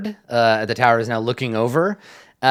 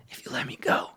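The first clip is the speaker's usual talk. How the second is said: whisper; 10 dB quieter than usual. Acted. Whispered offer repeated.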